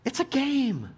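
high, indignant rant at the coach; mock outrage. Dad venting at son's baseball coach, high mock-indignant. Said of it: "I was like".

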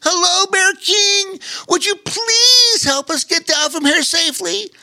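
an otter pleading loudly from the sky; otter calling down. Acted. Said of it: "called the young otter".

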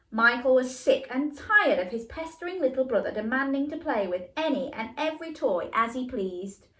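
usual speaking voice

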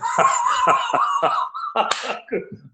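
long high-pitched laugh; real high, extended cackle; hearty laugh. Real, not acted. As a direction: burst out laughing at your own joke. Laughs at own joke about paying.

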